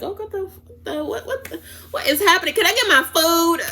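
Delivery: high mock-baffled voice, amused; bewildered customer. Imagined bewildered customer waiting in car.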